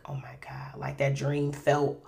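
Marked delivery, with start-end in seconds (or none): 0.1-0.8 whispered, retold heartbreak; silent weeping. Waking in bed after the dream, whispered weeping. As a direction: barely voice it, imitating quiet weeping. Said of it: "I was just weeping silently".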